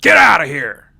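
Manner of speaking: very loud hammed-up shout; mock angry yelling. Comic as husband Frank chasing off a family, mock yelling. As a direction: shout them away like an irate husband. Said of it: "as Frank was yelling".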